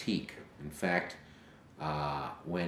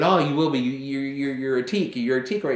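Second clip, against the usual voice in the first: loud, higher, thrilled reassurance; excited dad; 9 semitones higher, 11 dB louder than usual. Retold or imitated. Proud father assures his pledging son, loud and thrilled.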